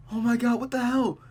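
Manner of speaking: ordinary talk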